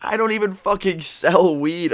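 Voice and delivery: high, drawn-out protest of innocence; indignant retold denial. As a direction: act out slow, exasperated disbelief.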